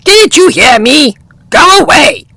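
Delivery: high bossy bird voice; cross little bird. Acted. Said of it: "said Oxpecker".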